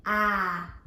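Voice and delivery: normal talking voice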